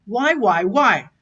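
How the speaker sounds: a light calling tone to mother; mock call to mother. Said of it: "I'm talking to my mother and I'm saying".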